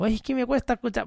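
a quick mock of a fussy listener; whiny complainer. Host imitating people who told him accents bother them, mock whiny. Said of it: "a mí me lo han dicho".

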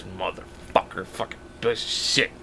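imitated low, slow cursing under the breath; drunken mumbling. Drunk man curses at nobody in an alley, imitated mumbling. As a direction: mumble curses very softly, barely voiced. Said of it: "I hear mumbling coming from an alley".

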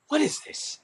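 high, airy, incredulous; mock disbelief. Ten-year-old watching Rachel get attention, mock disbelief. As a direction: play a kid thrown by a sudden rival. Child stunned by a rival's accents.

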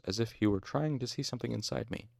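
ordinary delivery

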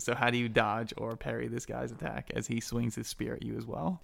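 ordinary delivery

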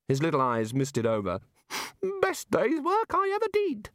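high, rapt god voice; high wistful little deity; enthusing god. A narrator's reading. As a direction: gush fondly about amoebas. God rhapsodises about amoebas.

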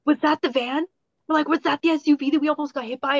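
high, quick alarmed question; surprised. Retold or imitated. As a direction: ask it alarmed and fast. Retold alarm at the SUV.

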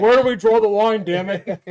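loud, high hammed-up outburst; mock outrage. Co-host mocks his friend's holiday rule, shouting in mock outrage. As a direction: blow up in pretend exasperation.

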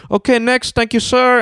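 high-pitched mock official voice; security staffer. Security waving passengers onto the boat, in a high put-on voice.

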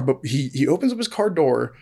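usual speaking voice

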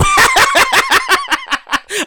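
long, very high cackle; cackling laugh. Real, not acted. Laughs after his refusal.

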